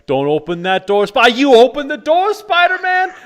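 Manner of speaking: high, loud, yelling at the screen; excited moviegoer yelling. Retold or imitated. Theater fan warning Spider-Man, high loud yell. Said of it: "that kind of thing where it's like".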